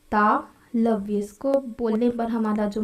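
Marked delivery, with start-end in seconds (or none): 0.1-1.2 तालव्य drawn out slowly, syllable by syllable; slow repeat. Shown as a demonstration. Teacher repeats the term for students, slow and deliberate. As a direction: stretch तालव्य out so students can copy it. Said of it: "इसको बोलते हैं तालव्य".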